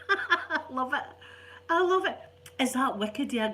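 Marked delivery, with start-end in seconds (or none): 0.1-2.2 delighted. Real, not acted. Gran delights in the grandson's cheek, high and gleeful. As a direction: burst with delight.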